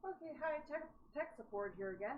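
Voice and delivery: chipper helpdesk caller voice; bright customer service greeting; tech support voice. Acted. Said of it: "I love my tech support voice".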